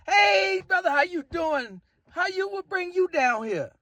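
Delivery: high happy hello; excited woman greeting. Retold or imitated. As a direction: greet him like a delighted woman at the bar. Woman greeting him, retold.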